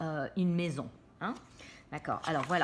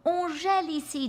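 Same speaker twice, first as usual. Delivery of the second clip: higher, louder cartoon Sammy, shivering line; Sammy's voice; 10 semitones higher, 5 dB louder than usual. A narrator's reading. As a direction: read it as a chilly cartoon kid. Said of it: "dit Sammy".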